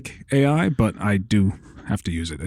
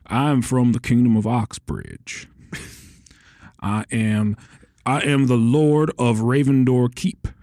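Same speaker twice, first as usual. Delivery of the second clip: slow, hammed-up Southern drawl; Southern accent; slower than usual. Shown as a demonstration. Game master voicing the Western Province, slow Southern accent. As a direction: stretch the vowels like a Southern lord. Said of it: "has a Southern accent".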